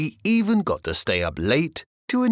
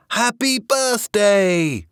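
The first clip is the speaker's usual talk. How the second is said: slow, drawn-out happy birthday call; 9 semitones higher, 4 dB louder, much slower than usual. A narrator's reading. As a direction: call it out together, slowly. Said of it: "they all called out".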